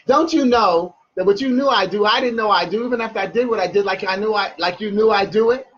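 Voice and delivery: fast spoken-word run; rapid-fire. Shown as a demonstration. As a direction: rattle the whole line off without a pause. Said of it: "I always say it that fast".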